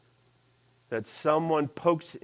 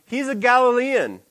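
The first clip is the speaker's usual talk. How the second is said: asserting; 6 semitones higher, 8 dB louder than usual. Retold or imitated. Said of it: "another asserted".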